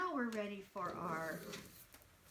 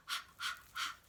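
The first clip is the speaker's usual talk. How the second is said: short voiceless puffs of "ha"; tired panting. Shown as a demonstration. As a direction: pant it out like someone worn out. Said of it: "He's so tired".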